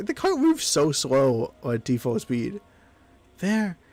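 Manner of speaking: ordinary talk, nothing marked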